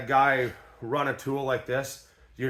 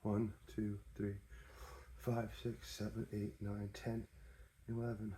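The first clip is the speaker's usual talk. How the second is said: much softer murmured counting; counting under breath; 6 semitones lower, 18 dB quieter than usual. Real, not acted. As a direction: count the threads softly to yourself. Counting threads quietly to himself.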